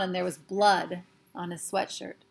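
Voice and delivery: ordinary delivery, nothing marked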